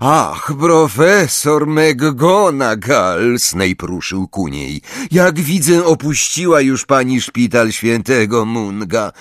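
slow, high, oily greeting; oily professor. A narrator's reading. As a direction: greet her with false politeness. Snape greets McGonagall.

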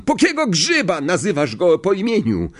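high-pitched indignant outburst; outraged yell. A narrator's reading. Stan scolds Harry for saying the name, outraged yell. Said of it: "ryknął Stan".

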